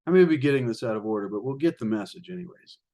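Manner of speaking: usual speaking voice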